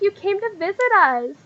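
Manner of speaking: put-on high, girlish delight; light mock squeal of welcome; excited squealing. Girls thrilled he came to visit, excited squealing. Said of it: "a bunch of excited squealing".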